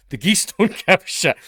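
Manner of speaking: exasperated retort breaking into a laugh. Retold or imitated. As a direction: laugh through the punchline at his wife. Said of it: "And I'm like".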